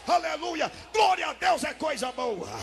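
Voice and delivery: high, loud shouting sermon; fiery preaching. Real, not acted. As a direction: blaze it out over the crowd. Preacher at full force.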